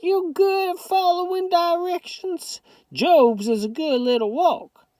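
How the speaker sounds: put-on high old Southern grandmother voice; Southern grandma. A narrator's reading. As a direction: scold lovingly as a granny. Grandma repeats question.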